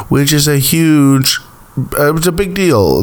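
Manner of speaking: put-on a passing, light Doctor Phil accent. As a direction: slip into a hint of a Southern TV-host accent. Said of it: "I threw a little Doctor Phil accent in there".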